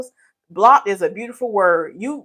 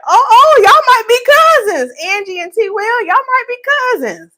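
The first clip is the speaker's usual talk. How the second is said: excited squeal; 13 semitones higher, 8 dB louder than usual. Real, not acted. Excited about viewers being related.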